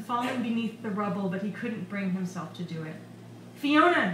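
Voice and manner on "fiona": higher, louder searching call; shouting. A narrator's reading. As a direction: shout the name into the rubble. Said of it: "he shouted, coughing".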